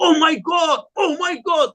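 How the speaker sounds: high, shouted cries of oh my God; imitating people calling on God in alarm; mock panicked cry. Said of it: "mereka bilang".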